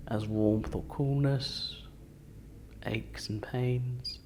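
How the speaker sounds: guided meditation. Acted. Leading seated group through a body scan, calm guided meditation. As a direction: guide them unhurriedly, letting each phrase settle.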